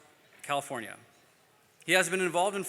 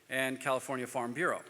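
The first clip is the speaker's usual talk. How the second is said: ordinary delivery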